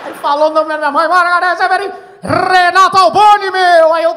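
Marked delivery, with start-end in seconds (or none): 1.1-3.9 loud slow TV host shout of the names; announcer shout. Retold or imitated. Host calls out the comedian's name, loud announcer. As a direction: call the names out like a variety show host.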